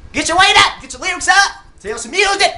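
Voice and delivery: high, loud scolding yell; shouting advice at the wannabe rapper; mock scolding shout. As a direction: bark orders like a fed-up coach. Scolding rapper to get serious.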